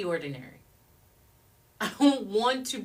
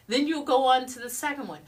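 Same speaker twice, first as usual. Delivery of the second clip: normal talking voice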